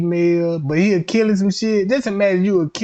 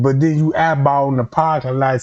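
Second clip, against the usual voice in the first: ordinary delivery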